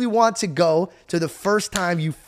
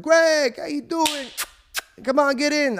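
bouncer greeting; 10 semitones higher, slower than usual. Retold or imitated. Security guy welcoming Greg at the party, high cheery greeting. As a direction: greet him like an old pal at the door.

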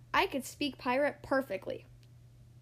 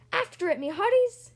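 loud, high rallying order; shouted captain's order; pirate command; 6 semitones higher, 6 dB louder than usual. A narrator's reading. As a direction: command your crew to charge. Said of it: "Braidbeard commanded".

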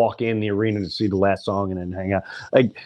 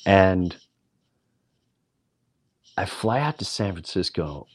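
ordinary talk

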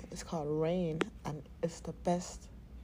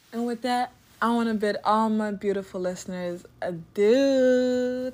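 sign-off; 2 semitones higher, 9 dB louder than usual. Real, not acted. Signing off the podcast.

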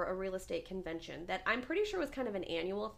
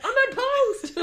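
high, loud comic shout through laughter; mock calling out; 13 dB louder than usual. Jogger greeting a lady running by, mock shout. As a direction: holler the introduction like an overeager jogger.

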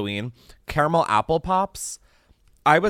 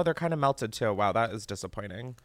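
normal talking voice

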